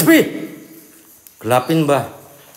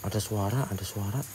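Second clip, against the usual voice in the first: much softer, lower murmur; softer, lower hush while listening; 7 semitones lower, 19 dB quieter than usual. Real, not acted. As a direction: barely voice it, straining to listen. Hearing noises in haunted building.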